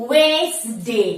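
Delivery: very slow Wednesday as wenes-day; two syllables. Shown as a demonstration. Teacher modelling it for students, two syllables. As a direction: stretch Wednesday into two parts, no middle D. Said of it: "also a two syllable word".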